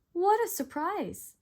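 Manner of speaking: high, loud surprised cry; startled Goldilocks. A narrator's reading. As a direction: blurt it out startled. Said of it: "she gasped".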